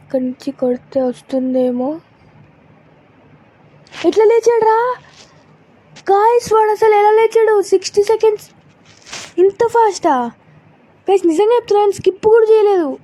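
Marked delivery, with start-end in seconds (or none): 4.0-13.0 high, loud shocked outburst; can't believe he got up; shocked disbelief. Real, not acted. Enemy got up impossibly fast. (ఆశ్చర్యంతో గట్టిగా)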